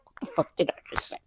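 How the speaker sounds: fast hushed snap; mock angry Sam. Sam cutting off Jacob about the treaty in a fast hushed snap. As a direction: bite it off under your breath. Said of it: "Okay, he didn't say it like that".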